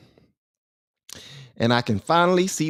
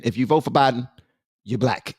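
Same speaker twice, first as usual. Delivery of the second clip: normal talking voice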